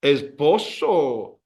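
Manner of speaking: "Esposo" drawn out slowly in Spanish; slow model word. Shown as a demonstration. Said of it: "now you say that you love your husband".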